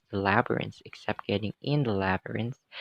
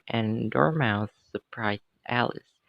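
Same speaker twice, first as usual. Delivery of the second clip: usual speaking voice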